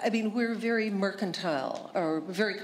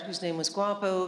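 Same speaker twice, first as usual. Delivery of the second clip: normal talking voice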